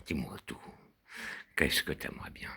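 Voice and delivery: soft teasing whisper; a playful unvoiced whisper. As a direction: breathe it close, coaxing.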